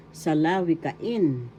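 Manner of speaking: salawikain, slowly, with IN stressed and drawn out; slow teaching model of salawikain, accent on IN; stress on fifth syllable. Shown as a demonstration. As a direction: stretch the final in of salawikain. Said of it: "syllable has the accent".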